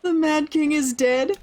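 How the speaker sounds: high, loud, slow astonished question; acted astonishment. God hears of the council's murder, acted astonishment. Voices god's surprise at news.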